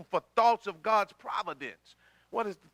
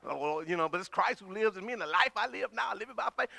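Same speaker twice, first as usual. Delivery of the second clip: usual speaking voice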